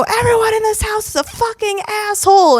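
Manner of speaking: high-pitched mock scream out the window; screechy drunken tirade, not at full volume; retold drunk scream. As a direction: rant at the neighbours in a high voice.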